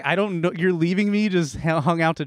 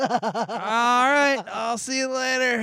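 lightly voiced laid-back doctor; breezy, higher doctor impression; chill doctor voice; 8 semitones higher, much slower than usual. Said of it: "he's like".

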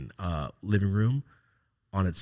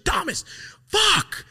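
high, loud fed-up curse; exasperated mom shout; 6 dB louder, slower than usual. Retold or imitated. Mom fed up with her son, exasperated shout. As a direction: snap his name then curse in frustration.

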